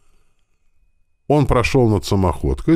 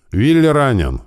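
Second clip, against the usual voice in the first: higher, moderately raised cry; narrated shout; 5 semitones higher, 4 dB louder than usual. A narrator's reading. Driver alerting the lieutenant to a casualty, raised shout. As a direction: call out the alarm. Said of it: "крикнул механик-водитель".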